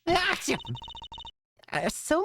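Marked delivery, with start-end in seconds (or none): 0.1-0.6 mock sneeze. Mimics the sneeze back.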